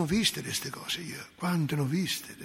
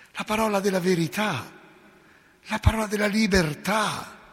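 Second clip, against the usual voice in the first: preaching crescendo; 4 semitones higher, 5 dB louder than usual. Acted. Preaching builds.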